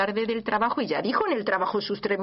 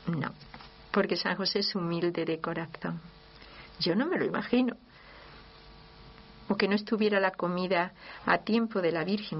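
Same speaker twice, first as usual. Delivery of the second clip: normal talking voice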